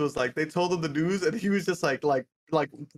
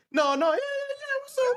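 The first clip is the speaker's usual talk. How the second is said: a much higher, hurried reassurance; nervous new partner. Retold or imitated. The new stepparent reassuring the kids, high and hurried. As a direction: squeak out quick yeahs like a stepparent caught off guard. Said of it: "new partner is like".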